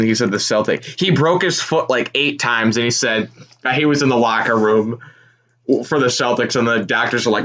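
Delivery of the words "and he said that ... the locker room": ordinary talk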